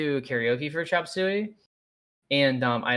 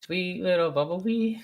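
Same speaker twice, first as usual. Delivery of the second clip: anime voice; 5 semitones higher than usual. Pretend. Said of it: "weeb voice".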